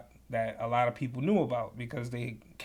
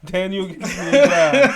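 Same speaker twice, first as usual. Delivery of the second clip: much louder, laughing title announcement; announcing title; 4 semitones higher, 12 dB louder than usual. Shown as a demonstration. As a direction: boom it like a show title. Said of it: "echoes title loudly".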